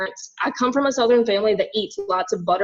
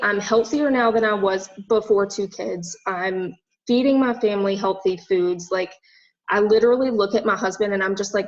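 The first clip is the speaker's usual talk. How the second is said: usual speaking voice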